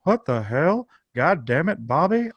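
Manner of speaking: a light take on Hank Hill's exasperated Texas drawl; lightly voiced Hank Hill, fed up with Bobby; Hank Hill impression. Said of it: "in your best Hank Hill impression, which I can do".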